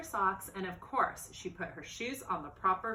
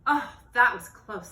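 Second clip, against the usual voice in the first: relieved exclamation; 2 semitones higher, 11 dB louder than usual. Acted. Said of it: "thought Beatrice".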